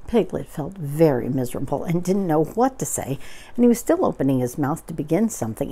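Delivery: usual speaking voice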